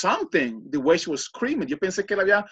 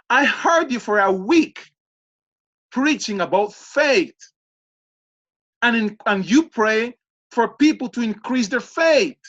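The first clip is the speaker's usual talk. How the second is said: higher, slow, emphatic retold challenge; incredulous challenge; 4 semitones higher than usual. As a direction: confront them point by point, puzzled. Retells confronting the woman in disbelief. (reproche incrédulo y enfático)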